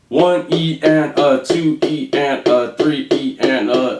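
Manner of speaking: counting out each sixteenth slowly; steady spoken count; slow counted beats. Shown as a demonstration. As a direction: call each subcount evenly like a metronome. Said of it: "So those are really slow".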